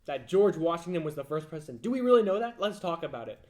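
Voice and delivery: mock an over-keen debater; mock profound questioning. Mocking imagined earnest questioner.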